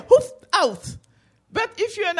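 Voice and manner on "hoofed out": pain exclamation. Shown as a demonstration. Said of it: "You're going to say".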